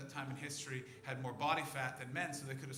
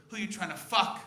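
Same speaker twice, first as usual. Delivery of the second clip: louder, higher heckler's yell; angry heckle; 9 semitones higher, 13 dB louder than usual. Retold or imitated. Audience mocking the comic, a rising heckling yell. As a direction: shout him down for the feminist claim. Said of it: "the rest of you are looking at me going".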